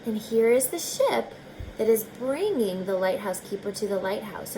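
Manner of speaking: ordinary delivery, nothing marked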